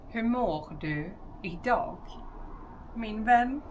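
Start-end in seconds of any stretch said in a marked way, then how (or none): none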